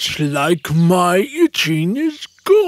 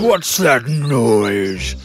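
deep, slow sleepy lion growl; drowsy lion grumbling from his cave; groggy lion; 11 semitones lower, slower than usual. Acted. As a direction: rumble it out low like a woken big cat.

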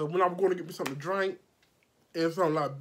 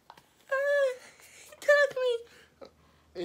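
very high, louder squeal of fake victimhood; mock accusation; 3 dB louder, slower than usual. Client mimicked crying out to her son, very high mock squeal.

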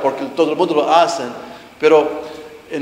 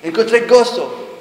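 agudo y fuerte, entusiasmo irónico; alegría fingida; 7 semitones higher, 6 dB louder than usual. Retold or imitated. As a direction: di con euforia burlona cómo creías hallar gozo. Recuerda su alegría de fiesta. (recordando la fiesta, con euforia irónica)

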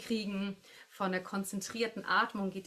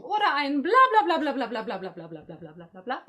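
louder babbled bla-bla run rising at the end; playful bla warm-up; 3 semitones higher, 5 dB louder, faster than usual. Shown as a demonstration. Voice teacher demonstrating a warm-up to her class, playful rapid babble. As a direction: rattle off the syllables like a vocal warm-up. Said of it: "Gleich noch mal".

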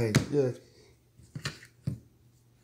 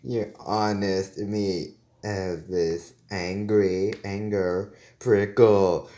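usual speaking voice

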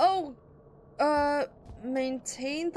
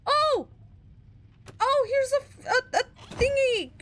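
high, louder flustered babble; panicking; 10 semitones higher, 4 dB louder than usual. Real, not acted. Player panicking to viewers at a found item, high and flustered. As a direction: trip over the words in a fluster. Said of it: "Oh my god, I'm panicking".

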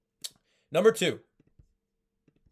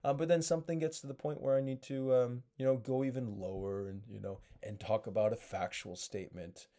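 lower, softer, dragging monotone; low flat voice; 4 semitones lower, 7 dB quieter than usual. Shown as a demonstration. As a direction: talk like this down in the basement. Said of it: "I'm just always talking like this".

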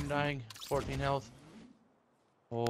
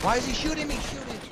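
panicked high yell. Real, not acted. Teammate under fire panics.

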